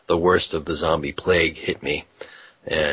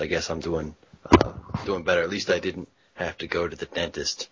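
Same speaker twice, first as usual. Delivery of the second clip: ordinary talk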